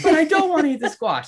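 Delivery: put-on high, loud, despairing kid wail; wailing child. Dad imitating his sobbing son, high loud wail. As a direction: wail it like a lost, sobbing toddler. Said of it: "I'm like".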